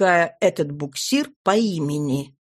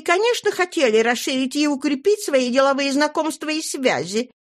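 rapid chatter; 7 semitones higher, faster than usual. A narrator's reading. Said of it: "с той же изумительной быстротой".

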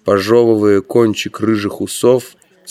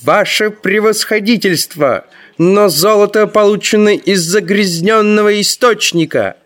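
high-pitched, agitated outcry; indignant cry; 12 semitones higher than usual. A narrator's reading. Ferl jumps up to protest to His Excellency, indignant cry. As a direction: cry out in protest, pitched well above normal. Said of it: "узколицый Ферл вскочил и крикнул".

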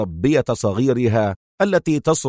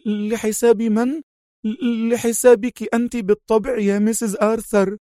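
terrified nanny; 11 semitones higher than usual. Acted. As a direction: answer in panic to the mistress. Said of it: "في ارتياع".

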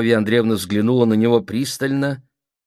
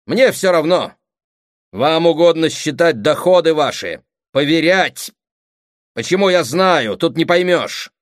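loud, raised-pitch retort; irritated quarrelling; 9 semitones higher, 7 dB louder than usual. Acted. Siblings bickering about income, irritated quarrelling. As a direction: argue back hotly.

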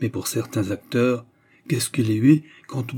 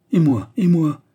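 higher, louder stamping outburst; furious cry; 6 semitones higher, 6 dB louder than usual. A narrator's reading. Jeanne furiously demands her own sentence from the jailer, shouting. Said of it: "cria-t-elle en trépignant avec fureur".

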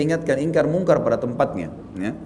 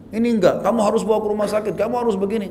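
higher-pitched, pushy acted demand; insistent demand; 6 semitones higher, faster than usual. Crash victim demanding a hospital trip, acted insistence. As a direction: press it like an aggrieved accuser.

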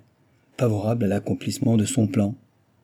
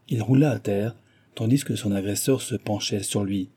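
usual speaking voice

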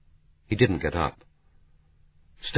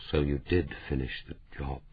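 hushed, lower and much softer, in horror; almost a whisper; 3 semitones lower, 7 dB quieter than usual. A narrator's reading. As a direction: let it drop to a near whisper. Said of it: "said almost in a whisper".

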